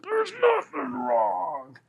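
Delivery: very high, drawn-out mock wail; tearful denial. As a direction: imitate insisting through tears, slowly. Retold tearful denial to the pastor.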